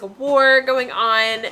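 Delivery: normal talking voice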